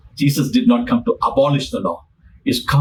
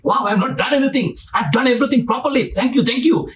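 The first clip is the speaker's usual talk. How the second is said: high, quick, eager self-praise; eager boasting; 8 semitones higher, faster than usual. Retold or imitated. Rich man eagerly listing his good deeds, high and fast. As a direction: rattle it off proudly. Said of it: "He was very excited".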